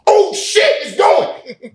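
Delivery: very high, loud squealed alarm; hammed-up high-pitched shout; retold excited yell. Boar bolts away from him, retold high excited yell.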